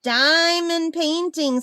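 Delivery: DIAMOND PAINTING drawn out slowly; slow repeat. Real, not acted. Owner repeating her hobby to pet bird, "Diamond painting" said slowly.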